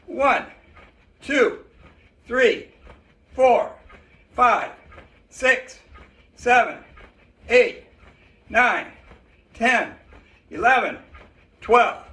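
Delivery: counting out loud while jumping; doing jumping jacks. Real, not acted. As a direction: call the count mid-exercise. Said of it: "Do 'em with me".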